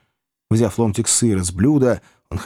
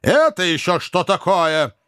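higher, slow indignant question; astonished Wardle; 8 semitones higher than usual. A narrator's reading. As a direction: demand in astonishment. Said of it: "мистер Ордль". (изумлённый вопрос)

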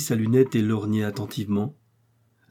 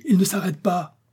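high-pitched, urgent cry; alarmed exclamation; 8 semitones higher than usual. A narrator's reading. Kennedy seeing Joey keep riding, alarmed exclamation. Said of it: "s'écria Kennedy".

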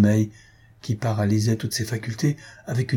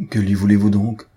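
louder, brusque demand; raised-voice challenge; abrupt cry; 5 dB louder than usual. A narrator's reading. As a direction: demand it sharply, out of nowhere. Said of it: "s'écria brusquement Albina".

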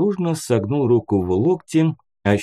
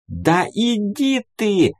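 very high, drawn-out squeal; indignant high-pitched yelp; squealing outburst; 11 semitones higher, slower than usual. A narrator's reading. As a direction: screech it in a cracking falsetto, stretching the words. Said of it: "заверещал Кидис, дав петуха голосом".

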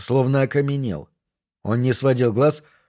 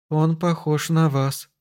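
slow, higher-pitched, tentative remark; hesitant resolve; 4 semitones higher, slower than usual. A narrator's reading. Shocked girl dares to point out a likeness, hesitantly. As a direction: venture it slowly and carefully. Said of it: "наконец решилась девушка".

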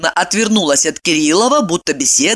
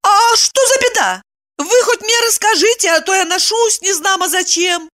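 high-pitched pleading female character; agitated woman, demanding; 13 semitones higher than usual. A narrator's reading. Woman asking what the disaster is, agitated. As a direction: press him for answers in a high voice.

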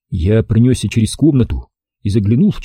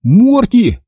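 high, moderately loud yell; 11 semitones higher, 3 dB louder than usual. A narrator's reading. Boy yells after his floating dog, alarmed. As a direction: call the name out high in alarm. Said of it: "завопил я".

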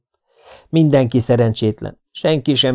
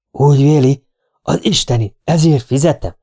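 testy man on the telephone; irritated client; 3 semitones higher, 4 dB louder than usual. Acted. Client regrets hiring a detective, irritated. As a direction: challenge your hired man down the line.

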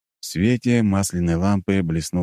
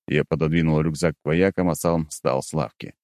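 normal talking voice

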